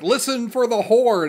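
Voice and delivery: high, loud call into the dark; yelling so he can find the car; Martin shouting. Retold or imitated. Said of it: "Martin's just like".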